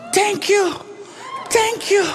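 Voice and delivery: put-on drunk excited thanks. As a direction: gush like a delighted drunk kid. Drunk narrator thanks knife maker.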